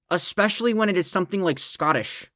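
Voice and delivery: ordinary talk, nothing marked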